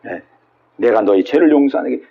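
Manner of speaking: ordinary delivery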